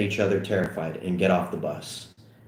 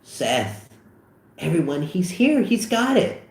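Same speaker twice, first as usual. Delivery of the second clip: lightly voiced girl, rising higher; woman voice; 8 semitones higher than usual. Jules announcing Seth to the party, lightly voiced woman. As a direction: announce him to the party in a girl's voice. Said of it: "woman voice ready".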